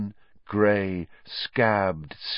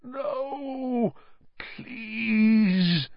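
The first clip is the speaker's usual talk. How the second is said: pleading moan; 12 semitones higher than usual. A narrator's reading. Sirius cowering before the Dementors, moaning pleas. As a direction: beg in a long high moan. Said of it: "he moaned".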